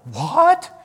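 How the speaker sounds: high, loud shocked what; disbelieving what. Retold or imitated. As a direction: blurt it out like a stunned soldier. Vets shocked by his answer.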